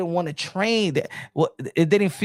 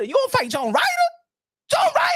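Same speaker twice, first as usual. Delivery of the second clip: incredulous and much higher on John Ryder; climbing higher in outraged disbelief; shocked disbelief. Real, not acted. Vlogger in disbelief at Canelo's opponent, shrieking higher.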